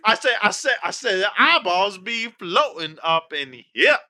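hammed-up Foghorn voice; Foghorn Leghorn impression. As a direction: puff up like a Southern cartoon rooster. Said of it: "Foghorn Leghorn".

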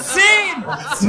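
shouted number; shouting. Real, not acted. Shouting bids in countdown.

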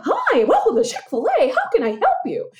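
high, bright, extra-friendly drive-thru welcome; sweet Chick-fil-A greeting. Retold or imitated. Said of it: "they all sound like this".

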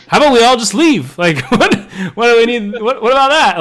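high, loud disbelief; can't believe the plan; incredulous. Real, not acted. Incredulous ranting about plot.

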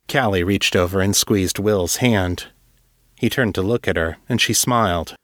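ordinary delivery

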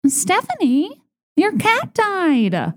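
high, singsong mock announcement, drawn out slowly; tactless sorority girl. Sorority girl announcing Stephanie's dead cat to the chapter, high mock singsong. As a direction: imitate a girl cheerfully broadcasting bad news. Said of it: "the tone in which she was like".

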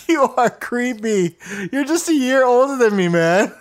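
laughing through the words; giggling tease, high. Real, not acted. Laughing while teasing his cohost.

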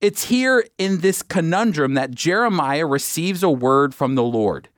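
ordinary talk

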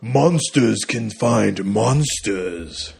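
slow, menacing villain quote; Oldman villain line. Retold or imitated. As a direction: drag each word out like a crazy villain. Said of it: "Like that".

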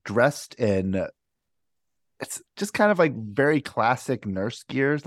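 ordinary talk, nothing marked

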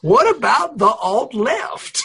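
put-on high, very slow Mark Twain accent; Donald Trump. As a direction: draw it right out in a folksy Mark Twain accent. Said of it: "in that voice".

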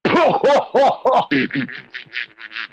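big hooting "ho ho" laugh. Real, not acted. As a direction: burst out laughing at the joke, trailing off. Laughs at listener's Cosmos dev joke.

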